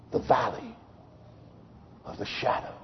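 whispered, barely audible; hushed awe, whispered to the congregation. Acted. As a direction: breathe the words, almost silent. Whispered scripture phrase after climax.

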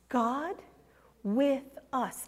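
hushed reveal. Acted. Answering the meaning of Emmanuel.

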